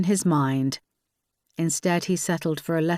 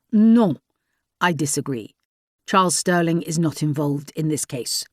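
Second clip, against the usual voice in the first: put-on lightly French-accented, dismissive official; a hint of a curt French commissioner; 4 dB louder than usual. A narrator's reading. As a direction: brush her off in a French accent. Commissioner disagreeing.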